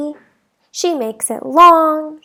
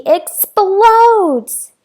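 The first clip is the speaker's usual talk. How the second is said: louder, drawn-out burst; exploding; 6 semitones higher, 8 dB louder than usual. A narrator's reading. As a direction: let it blow up, slowly and loud. Girl loses her temper.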